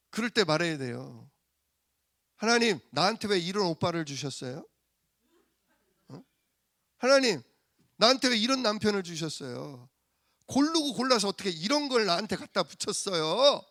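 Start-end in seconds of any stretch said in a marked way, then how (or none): 2.4-13.6 angry prayer. Acted. Wife complaining to God about her husband, acted temper. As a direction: protest loudly to God, exasperated. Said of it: "승질은 똑같이 내는데요".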